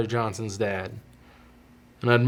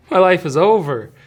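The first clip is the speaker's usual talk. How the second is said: high, loud, melodramatic wail; retold freak-out; 7 semitones higher, 8 dB louder than usual. Dad-to-be retelling his worst reaction, mock freaking out. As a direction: overplay the despair for comic effect. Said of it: "freaking out".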